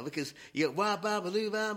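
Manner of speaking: ordinary delivery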